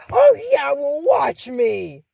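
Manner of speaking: a leap up high on oh, then a slow taunt; spoiled princess defies her friends, high and slow; defiant sass. Acted. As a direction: dare them to stop you. Amber defiantly leaving.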